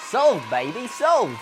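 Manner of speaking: celebratory tag line; playful echo. Acted. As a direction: sing it back triumphantly. Echoing the sign-off.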